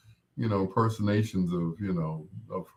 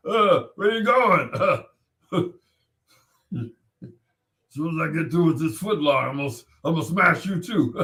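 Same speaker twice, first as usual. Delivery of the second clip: higher, fast hammed-up bully threat; Big Jake Nasty; 6 semitones higher than usual. DJ's bully character threatening again, higher and fast. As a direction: rattle off the threat quickly. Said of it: "Big Jake Nasty".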